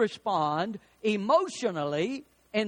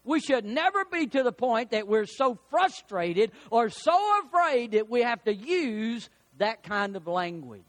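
ordinary talk

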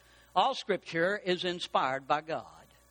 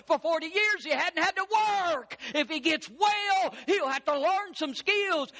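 driving sermon list, climbing higher; building preaching; 7 semitones higher than usual. Real, not acted. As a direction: pile up each point with rising fervor.

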